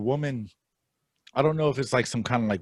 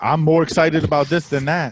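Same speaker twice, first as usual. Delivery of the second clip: loud, full-chested declaration; 3 semitones higher, 9 dB louder than usual. Real, not acted. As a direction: declare it boldly, much louder. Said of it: "I'll say that with my chest".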